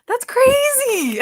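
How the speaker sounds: slow high amazed wail; stunned. Real, not acted. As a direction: stretch it out in shock. Reacting to shocking book plot.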